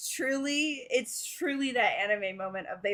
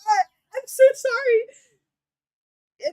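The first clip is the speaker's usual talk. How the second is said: loud, high, breaking flustered apology; tearful embarrassed panic; panicked apology; 9 semitones higher, 9 dB louder than usual. Acted. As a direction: sob out a frantic sorry. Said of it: "and goes".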